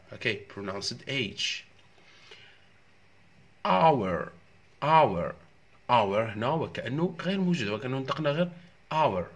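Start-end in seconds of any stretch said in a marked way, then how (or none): 3.7-4.3 "Hour" said as "our", loud and high; silent H. Shown as a demonstration. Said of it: "هنا راح تكون في silent H". 4.8-5.3 "Hour" said as "our", loud; no h at all in "Hour"; silent H. Shown as a demonstration. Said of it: "هنا راح تكون في silent H".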